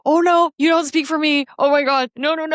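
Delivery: high-pitched retold inner panic; comic dread, pitched well up; mock horror. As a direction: protest in exaggerated dismay. Retold inner horror at being spoken for.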